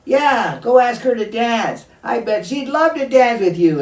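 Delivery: put-on loud, high, jeering voice of teasing boys; mocking taunt. Friends loudly taunting little Scarface about the chief's daughter. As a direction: sneer it out like bullies daring him. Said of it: "so-called friends would chide him and say".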